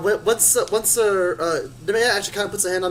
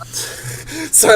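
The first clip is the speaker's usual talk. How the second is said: laughing apology; 10 semitones higher than usual. Real, not acted. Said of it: "distracted by the echo".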